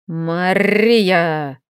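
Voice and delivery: грозный протяжный рык; прорычи имя с нажимом; рык дракона. Acted. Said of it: "снова рыкнул он". (драконий рык)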